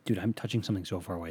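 normal talking voice

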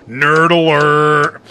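low, slow, drawn-out warning voice; deadpan mock alarm; mock nerd alert. Mock alarm after fact.

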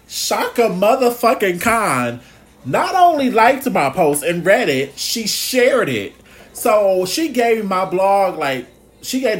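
slowing down on "she shared it" for effect; excited. Real, not acted. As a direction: gush about the star who shared your post. Excited about [name] sharing.

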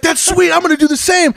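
very high, imitated enthusiasm; mock eager brother. Brother wants the same course, mock eagerness. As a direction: gush as the delighted brother.

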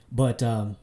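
ordinary talk, nothing marked

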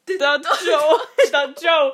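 loud laughing words. Real, not acted. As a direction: laugh while speaking. Laughing fit.

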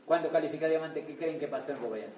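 ordinary talk

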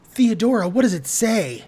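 high, loud insistent demand; urgent anxiety. A narrator's reading. Marcy Marcy pressing stunned Theodora to read, urgently anxious. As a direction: demand an answer by name, urgently.

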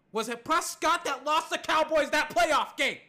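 loud, high indignant question; heated challenge. Real, not acted. Host challenges fans blaming Prescott for playoff loss, heated.

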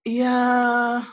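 put-on bored valley girl drawl; lazy, bored and unimpressed. Shown as a demonstration. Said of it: "sound like bored Valley Girl".

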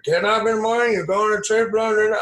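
high, sing-out mock broker patter; stockbroker impression. Stockbroker describing his working day, high mocking imitation. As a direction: rattle off his routine up high, mimicking him.

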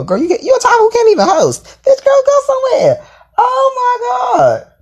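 very high, half-crying exasperation; high, wailing "oh my God"; exasperated shriek. Real, not acted. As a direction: cry out in disbelief. Exasperated at the top.

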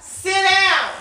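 yelled order, high and loud; shout. Real, not acted. Telling kids to sit down.

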